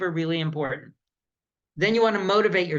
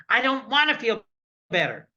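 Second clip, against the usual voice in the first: mildly crabby, higher then dropping on the last word; crabby refusal; 4 semitones higher than usual. Acted. Lucy turns down Linus's pampering, crabby refusal. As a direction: dig your heels in, a touch grumpy.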